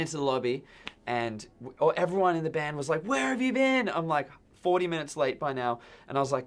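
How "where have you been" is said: much higher, louder cry of disbelief; band's shocked demand. Retold or imitated. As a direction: squeal it like worried bandmates. Band members demanding where he was.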